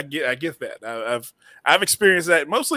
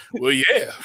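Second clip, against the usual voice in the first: laughing agreement; 3 semitones lower than usual. Retold or imitated. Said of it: "we're like".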